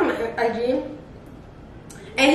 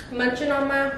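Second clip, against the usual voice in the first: mouth full; 2 semitones lower, 7 dB quieter than usual. Real, not acted. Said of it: "I'm talking with my mouth full".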